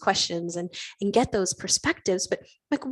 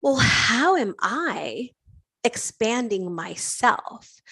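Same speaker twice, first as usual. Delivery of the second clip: slow musing; 2 semitones higher, much slower than usual. Retold or imitated. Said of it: "I was like".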